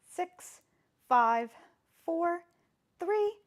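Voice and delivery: high, loud numbers pushing the class on; energetic countdown. Real, not acted. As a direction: call out each count to keep them holding. Calling out the countdown.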